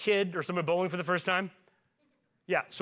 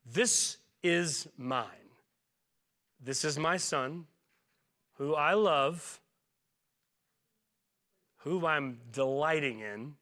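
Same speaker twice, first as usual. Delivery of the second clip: the Father declaring his love for the Son, slow and tender; God the Father speaking; 3 semitones lower, much slower than usual. Acted. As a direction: let each phrase land with love. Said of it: "The Father says".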